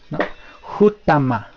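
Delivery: normal talking voice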